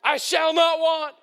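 a preacher's shouted reply to himself. Acted. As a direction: cry it out to the back row. Quiet take then shouted repeat.

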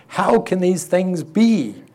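high, slow, baffled question; bewildered Nicodemus. Acted. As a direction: throw up your hands and ask it, bewildered. Nicodemus throws up his hands.

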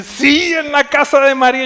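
high, loud retold crowd reply; excited answer. Tour group answers the pastor's question, excitedly. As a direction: call it back like an eager crowd.

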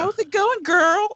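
high, overly sweet mock greeting to a friend. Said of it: "The way they say".

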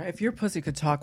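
ordinary talk, nothing marked

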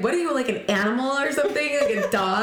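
high, fast mock disgust; imitating a grossed-out friend; disgusted Liv. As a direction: tease her like she's a dog. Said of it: "And she's like".

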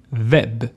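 Web with a German-style W, a v sound; isolated "Web", v at the start; Swedish pronunciation model. Shown as a demonstration. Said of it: "zwei Wörter mit W nachsprechen".

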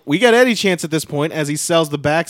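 ordinary talk

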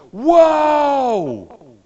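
huge shouted "Wow!", pitch leaping up; full-volume acted shout of amazement; too-loud wow. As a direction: blast it out in exaggerated amazement. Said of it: "I did that too loud".

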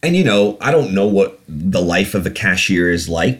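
ordinary talk

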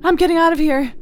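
high loud frantic; panicked. Acted. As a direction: scramble away in panic. Fleeing scared.

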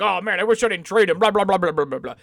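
loud mock lament running into blah-blahs; mocking whiny fans. Sports podcaster mocking fans who regret a trade, loud exaggerated griping.

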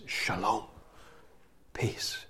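soft, breathy shalom then a whispered peace; acting out Jesus, a gentle whisper; hushed whisper. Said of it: "act it out".

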